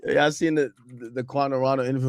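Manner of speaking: ordinary delivery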